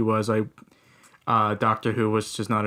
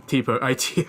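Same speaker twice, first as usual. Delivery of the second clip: ordinary talk, nothing marked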